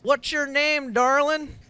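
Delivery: high, loud hammed-up Southern drawl; Southern accent. Commentator doing a requested Southern accent for the guest, hammed-up Southern. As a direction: camp it up in a mock Southern accent. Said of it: "That's one version of a Southern".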